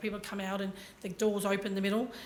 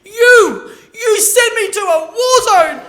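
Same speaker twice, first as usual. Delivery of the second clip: furious shout, much higher and louder; yelling; 13 semitones higher, 17 dB louder, much slower than usual. Retold or imitated. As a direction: scream it across the airport. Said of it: "he yells at the top of his voice".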